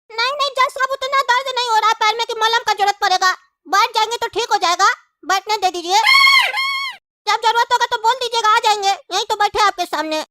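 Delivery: high, squeaky put-on witness; a pipsqueak whining to the judge; whiny witness voice. Witness declines ointment, squeaky voice.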